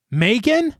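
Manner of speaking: high, hopeful "Megan?"; eager hopeful question. Acted. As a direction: answer the phone hoping it's her friend. Samantha eagerly answers the phone.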